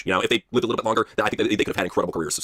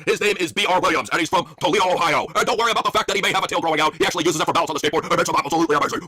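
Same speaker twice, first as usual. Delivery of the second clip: higher, booming event announcer; excited sportscaster; 7 semitones higher than usual. Acted. Announcer welcoming X Games viewers, high excited voice. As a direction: hype up the broadcast.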